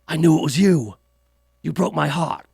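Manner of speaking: low mafia movie line; Godfather impression. Mafia boss confronts Fredo, in a low put-on voice. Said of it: "We're talking about The Godfather".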